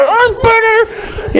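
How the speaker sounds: very high, slow, squeaky cry; a high falsetto wince; mock squeal. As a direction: squeal it out daintily. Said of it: "you stand on Spider-Man's arm".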